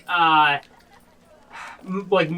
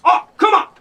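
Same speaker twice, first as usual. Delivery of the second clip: exasperated yell; 9 dB louder than usual. Acted. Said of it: "He goes".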